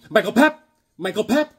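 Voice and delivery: high name call with rising end; teasing catchphrase. Retold or imitated. Teasing a phone company coworker with his name, high and rising. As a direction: chirp the name up to needle him.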